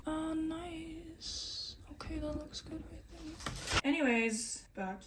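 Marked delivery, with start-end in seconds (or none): 0.1-3.8 soft, high whisper; pleased whisper. Real, not acted. Cook whispering approval at the pan. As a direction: murmur approval under breath.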